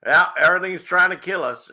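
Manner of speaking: mock Australian accent. Host imitating unbothered Australians to viewers, mock Australian accent. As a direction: put on a rough go at an Australian accent. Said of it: "that's my Australian accent".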